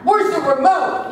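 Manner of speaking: high, loud panicked question; mock-panicked husband demanding the remote; frantic like the house is on fire. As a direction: ask as if the house were on fire. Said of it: "like, you know, the house is on fire".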